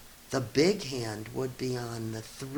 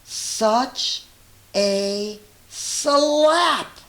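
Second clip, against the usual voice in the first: excited build; 7 semitones higher, 8 dB louder, much slower than usual. Acted. Climax of the whale's slap.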